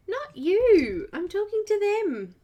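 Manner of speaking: high, loud telling-off; exasperated, high-pitched correction to a pet; playful scolding. Real, not acted. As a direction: raise your voice to correct the pet. Scolding noisy pet during live stream.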